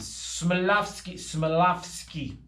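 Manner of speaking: Smilovsky sounded out slowly, syllable by syllable; slowly working out the name Smilovsky; careful name pronunciation. Shown as a demonstration. Host carefully sounding out a viewer's name Smilovsky. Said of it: "I think I said that right".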